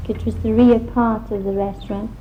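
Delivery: ordinary talk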